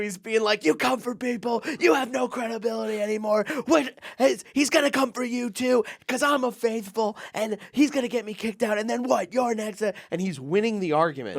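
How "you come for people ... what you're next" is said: mock yelling. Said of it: "He's yelling at him".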